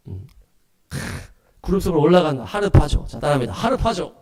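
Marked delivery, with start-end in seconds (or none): none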